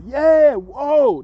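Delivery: high yelled call; mock scream. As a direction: holler it out high. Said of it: "They don't scream out".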